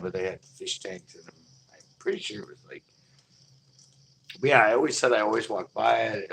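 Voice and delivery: usual speaking voice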